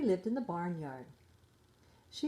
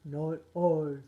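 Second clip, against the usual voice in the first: lower, louder grunty pig voice; grunting pig; 3 semitones lower, 6 dB louder than usual. A narrator's reading. Pig refuses to help carry the wheat, in low grunting voice. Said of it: "the pig grunted".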